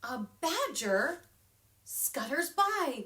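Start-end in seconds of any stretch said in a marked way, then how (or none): none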